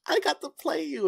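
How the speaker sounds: high-pitched fan excitement; retold starstruck squeal; excited. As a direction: gush to your hero, voice shooting up. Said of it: "I was so excited".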